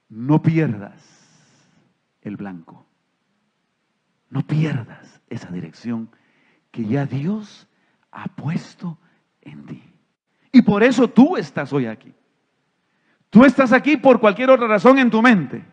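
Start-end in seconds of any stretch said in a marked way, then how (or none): 0.1-9.8 intense whisper. Real, not acted. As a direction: lower and much softer, nearly breathed. Preacher urges congregation, intimate moment.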